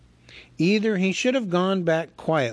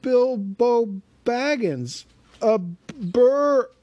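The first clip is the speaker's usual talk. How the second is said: frightened hobbit; 9 semitones higher, 5 dB louder, much slower than usual. A narrator's reading. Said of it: "shaking all over".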